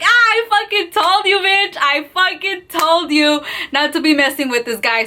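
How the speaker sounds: high-pitched imitated I-told-you-so; gloating cousin. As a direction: crow over someone, mimicking an amused cousin. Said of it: "my cousin's laughing her fucking ass off".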